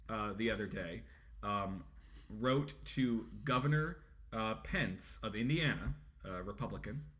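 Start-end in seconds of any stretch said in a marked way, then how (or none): none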